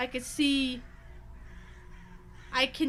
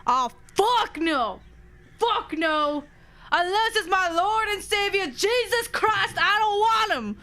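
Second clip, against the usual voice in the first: horrified protest; 5 semitones higher, slower than usual. Real, not acted. Reacts in mock horror to story.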